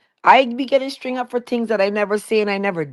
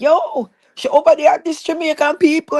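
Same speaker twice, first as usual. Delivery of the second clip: raised-pitch mock rumour-monger; imitated accusers; 6 semitones higher than usual. Accusers spreading a rumour about her, higher-pitched imitation.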